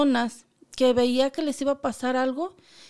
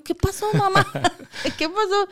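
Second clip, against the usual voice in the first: laughing question; 6 semitones higher than usual. Real, not acted. Laughing asking mother.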